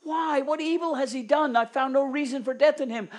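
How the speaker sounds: high, loud pleading sermon voice; impassioned preaching. Acted. Preacher voicing Pilate pleading with the crowd, high loud preaching.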